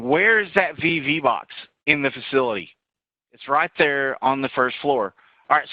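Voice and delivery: usual speaking voice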